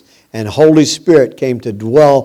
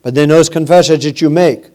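quick, urgent sermon drive; fiery preaching; 3 semitones higher, 4 dB louder than usual. Acted. Preacher urging his flock about confessions, fervent. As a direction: press the point home on the congregation.

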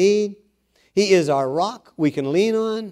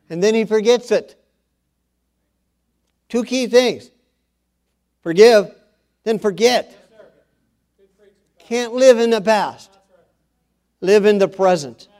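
higher, louder preaching voice, each phrase punched; emphatic preaching; 6 semitones higher, 5 dB louder than usual. Acted. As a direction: preach it out to the congregation. Preacher raises voice on forgiveness.

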